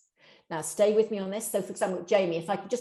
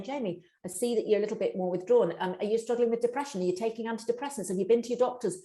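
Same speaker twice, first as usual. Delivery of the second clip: rushed diagnosing questions; faster than usual. Shown as a demonstration. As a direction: fire off questions like an amateur diagnosis. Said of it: "Don't be saying".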